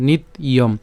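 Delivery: "নিত ইয়ম" broken into nit and iyom; "নিত ইয়ম" carefully separated for learners; split pronunciation. Shown as a demonstration. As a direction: stop on the t of "নিত", then say "ইয়ম". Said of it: "ভেঙে উচ্চারণ করি".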